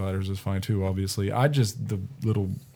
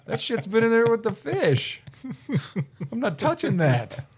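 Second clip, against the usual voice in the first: incredulous refusal; 11 semitones higher than usual. Retold or imitated. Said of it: "and I was like".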